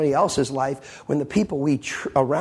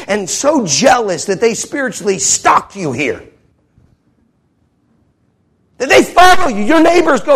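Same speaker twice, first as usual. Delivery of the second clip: loud, high, driving sermon voice; fiery preacher; preaching at full force; 7 semitones higher, 8 dB louder than usual. Acted. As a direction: hammer it home from the pulpit.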